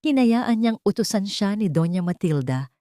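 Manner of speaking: ordinary talk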